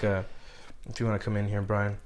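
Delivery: ordinary delivery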